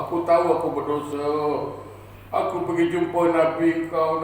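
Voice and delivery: acted weeping prayer; tearful pleading. Rejected sinner crying to Allah, acted tearful pleading. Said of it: "Dia menangis".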